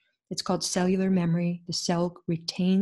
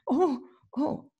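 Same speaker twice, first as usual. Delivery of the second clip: startled; 8 semitones higher than usual. Retold or imitated. Feeling nephew's pain.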